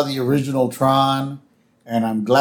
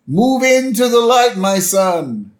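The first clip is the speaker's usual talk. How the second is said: high, calling preacher voice; Reverend Lovejoy; 9 semitones higher, 3 dB louder, slower than usual. Retold or imitated. Host as reverend calling to lost Homer, calling out. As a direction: call out solemnly into the distance.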